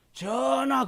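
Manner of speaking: sharp, high, loud call to the king; courtier's cry. Acted. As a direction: call out boldly without fear. Acted courtier calling to king. (임금에게 외치는 신하)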